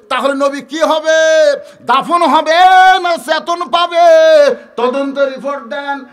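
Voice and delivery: loud, insistent questioning; urgent demand. Acted. Companions pressing Ali about the Prophet's burial, loud urgent cries. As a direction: cry the questions out, holding the endings.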